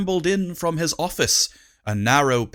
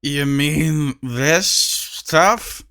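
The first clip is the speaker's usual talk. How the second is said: put-on slow slurred drunk voice; much slower than usual. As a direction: slur it slowly like a drunk. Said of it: "asked a dwarf with a slurred voice".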